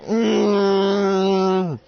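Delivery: long, high hum of pain; sustained high "mmm"; pained muffled moan. Acted. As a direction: moan through closed lips like a hurt captive. Tied captive with knife in leg.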